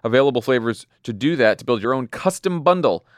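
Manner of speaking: cheerful podcast ad voice; ad read. Acted. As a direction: pitch the cereal like a host ad.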